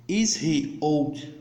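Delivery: slow, carefully spaced model question; slow demo English. Shown as a demonstration. Teacher to students learning English, slow and deliberate. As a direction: spell it out slowly for beginners. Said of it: "Então, nós podemos falar".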